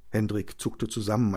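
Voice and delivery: ordinary delivery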